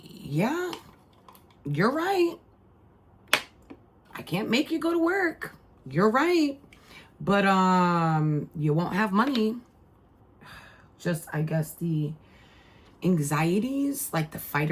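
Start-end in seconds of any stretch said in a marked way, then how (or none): 0.1-9.6 higher, sing-song sarcastic agreeing; mother teasing her daughter with fake agreement; mock agreement. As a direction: sweetly humor her, then land the catch.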